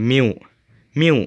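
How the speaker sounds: "milk" said as "miw", no l or k; common mispronunciation. Shown as a demonstration. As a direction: say "milk" ending in a w glide. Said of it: "จะอ่านว่า milk".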